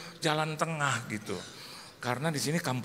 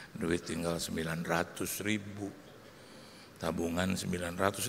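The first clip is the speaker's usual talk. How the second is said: low, slow murmur to his wife; slow, discreet; 9 semitones lower, 6 dB quieter than usual. Retold or imitated. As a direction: confide it softly and slowly, very low. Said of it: "saya pelan-pelan ke istri saya".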